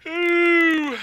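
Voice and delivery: sustained, very high "ooh"; piercing drawn-out ooh; high loud whoop. Real, not acted. Sudden high loud cry while playing.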